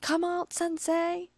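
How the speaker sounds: high calling yell; shouting. A narrator's reading. The stalker shouting through the door for her author. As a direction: demand he come out.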